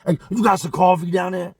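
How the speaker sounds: loud, pushy demand; aggressive. Retold or imitated. Trap demanding coffee, all aggressive. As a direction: lean on him hard with it. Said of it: "All aggressive like".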